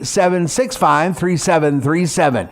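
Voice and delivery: usual speaking voice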